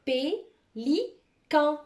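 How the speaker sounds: Pélican very slowly in three separated syllables. Shown as a demonstration. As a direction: pause between pé, li and can. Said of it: "se compose de trois syllabes".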